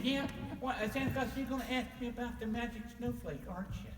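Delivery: little snowman voice. Acted. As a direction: sound like the newest, youngest snowman. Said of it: "That's how they talk".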